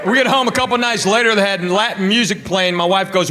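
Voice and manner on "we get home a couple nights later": normal talking voice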